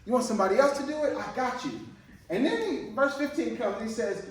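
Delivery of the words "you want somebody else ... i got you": higher, fast and keen; eager Moses. Retold or imitated. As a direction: rattle it off like an eager volunteer. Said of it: "Moses was like".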